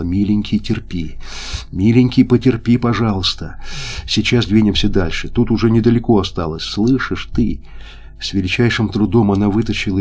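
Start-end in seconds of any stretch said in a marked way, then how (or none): none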